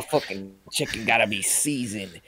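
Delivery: put-on emphatic candidate voice; Dontarius. Candidate arguing chicken must be seasoned, put-on impassioned voice. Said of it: "And he's like".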